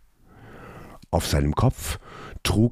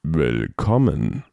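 slow, deep, rumbling welcome; deep trembling voice; 3 semitones lower than usual. A narrator's reading. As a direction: let a low voice tremble slowly. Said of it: "sagte das Wesen langsam, und die tiefe Stimme bebte".

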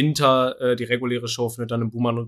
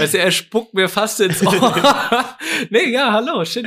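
talking through laughter; laughing; 9 semitones higher, 5 dB louder than usual. Real, not acted. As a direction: laugh while you speak. Laughing about host's greeting.

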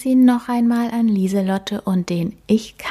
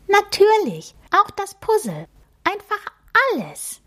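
much higher, tiny and sweet mouse voice; a cute magic mouse introducing herself; little mouse voice. Acted. The magic mouse introduces herself to Emma, high and squeaky.

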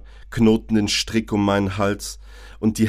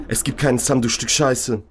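sneering voice in his head, not raised; mocking inner voice. The voice in Georg's head sneers at him, mocking. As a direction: taunt him with a cold sneer.

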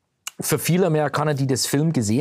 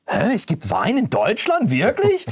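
mock disbelief; 10 semitones higher than usual. Americans' disbelief about German wine.

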